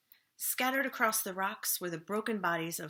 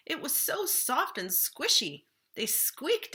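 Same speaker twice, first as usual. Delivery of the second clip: higher, louder, squealing with delight; 7 semitones higher, 3 dB louder than usual. A narrator's reading. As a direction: gush like a thrilled otter. Otters delight in foam.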